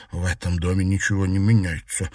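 ordinary delivery

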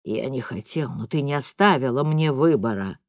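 cold, low dad; father justifying himself, low and cold. A narrator's reading.

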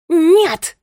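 high, loud furious cry; angry scream. A narrator's reading. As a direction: scream the refusal, enraged. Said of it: "закричала она". (яростный крик)

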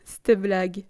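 laughing disbelief, as a character; laughing exclamation. A narrator's reading. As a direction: exclaim through an acted laugh. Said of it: "se mit à rire ... et s'écria".

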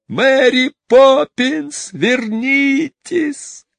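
high, slow drawn-out call; desperate high shout of her name; children crying out. A narrator's reading. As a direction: call her back across the sky. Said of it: "кричали они".

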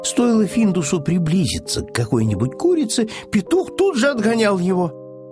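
ordinary delivery, nothing marked

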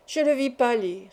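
ordinary delivery, nothing marked

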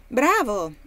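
loud pleased exclamation; a hearty well-done; exclaiming. Acted. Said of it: "esclamò Haley". (esclamazione forte e compiaciuta)

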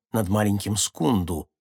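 usual speaking voice

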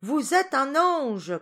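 slow, high, full shout. A narrator's reading. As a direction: bellow each word for a deaf listener. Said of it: "cria-t-il".

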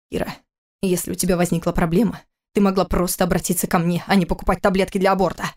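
ordinary delivery

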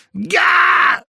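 high, loud rooster screech; full-throated yell. A narrator's reading. Rooster hearing talk of hens, full-throated screech. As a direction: yell at the top of your lungs. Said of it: "крикнул во всё горло".

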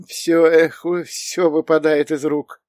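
put-on high, slightly loud old woman's voice, not truly whispered; шепчущая старушка. A narrator's reading. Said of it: "Её шёпот был похож на шелест высохших листьев". (слегка изображённая старушка, высокий голос)

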